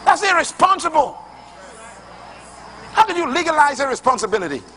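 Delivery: high, ringing pulpit voice; climbing sermon cadence, driving each phrase; full-force preaching. Acted. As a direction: preach it hard to the congregation. Preaching continues after reply.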